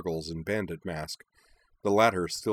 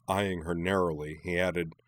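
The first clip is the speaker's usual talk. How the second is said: ordinary delivery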